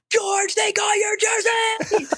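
yelling to her over the crowd; excited shout. Retold or imitated. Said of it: "he's like".

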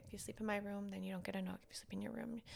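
put-on hushed, fast whisper to a sleepy child; whispered offer. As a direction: whisper the bedtime deal quickly. Mother whispers bedtime choice to child.

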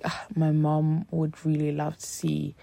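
softly humouring the caller, uninterested; bored politeness. Retold or imitated. Replying to an ex-friend about her baby, bored. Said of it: "I'm just, like, bored by this conversation".

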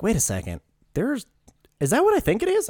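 can't believe what you're seeing; dawning surprise. Retold or imitated. Retelling spotting the moons, awed and rising.

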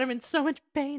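high whimpering complaint; a hammed-up pained whimper; mock crying. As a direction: whine it like you're in pain.

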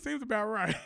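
usual speaking voice

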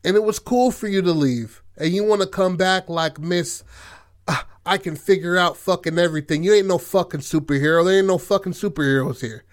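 indignant rant. Acted. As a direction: let loose an exasperated, fed-up rant. Brother's angry rant in retelling.

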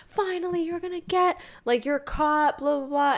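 high-pitched mock triumph; excited. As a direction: imitate captives giddy at being rescued. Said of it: "excited that they're like".

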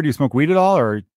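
normal talking voice

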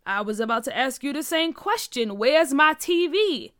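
furious. A narrator's reading. Dad angrily asking his son where the TV went. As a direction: build into an angry demand. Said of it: "his dad said furiously".

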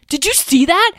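excited question. Acted. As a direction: ask it with wide-eyed delight. Lyle thrilled, repeats question.

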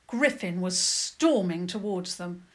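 ordinary delivery

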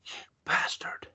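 slow, soft whispered American wrestler line; Hulk Hogan. Retold or imitated. Mocking the film's weak delivery to his co-host, slow whispered impression. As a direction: breathe the line out weakly in a mock American accent. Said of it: "my American accent was better than Hogan's there".

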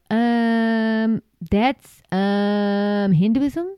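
hesitant, questioning mock reply; mock puzzled. Retelling her reply to the church's yoga class, mock puzzled. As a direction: act politely baffled, ending on a rising question. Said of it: "and I was like".

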